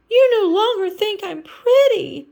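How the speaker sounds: high, loud mock wail. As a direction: wail it in distress. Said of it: "she wailed".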